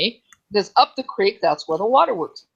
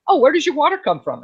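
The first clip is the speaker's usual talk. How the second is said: higher-pitched imitation of a curious local; curious questioner; 7 semitones higher than usual. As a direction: ask it as an interested newcomer-greeter would. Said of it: "they talk to me and say".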